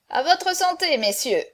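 high, hearty toast to the company. A narrator's reading. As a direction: call out a toast, lifted pitch. Hunchback raises a toast to company.

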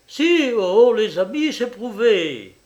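loud, high, anguished insistence; desperate outburst, pitched high; dreadful anguish. A narrator's reading. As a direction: cry it out in anguish. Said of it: "une affreuse angoisse".

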